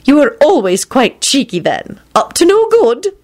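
put-on high, scolding-fond elderly lady; teasing old woman. A narrator's reading. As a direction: tease him fondly. McGonagall teasing.

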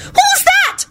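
high, piercing frightened shriek. A narrator's reading. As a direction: scream it out in alarm.

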